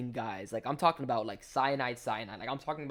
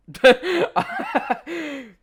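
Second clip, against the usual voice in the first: high burst of real laughter; laughing; 13 dB louder than usual. Real, not acted. Cracking up at his own joke about the queen, high loud laugh.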